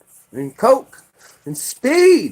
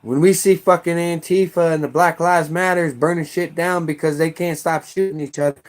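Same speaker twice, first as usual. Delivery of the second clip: ordinary delivery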